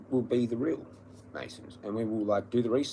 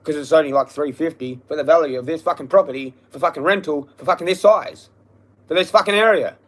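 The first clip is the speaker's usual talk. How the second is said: loud, raised-voice outrage; angry rant; 4 semitones higher, 9 dB louder than usual. Real, not acted. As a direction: hammer each complaint home, pitch climbing. Ranting about the rent's value.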